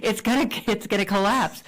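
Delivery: hammed-up panic about the flimsy table; retold alarm. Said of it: "I go".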